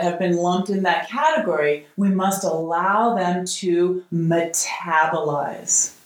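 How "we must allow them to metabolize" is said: "allow" stretched slow and low; slow stress on allow. Shown as a demonstration. Teaching the favorite word, slow stress on "allow". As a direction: lean into "allow", slowed right down. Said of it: "Here's the word that I love".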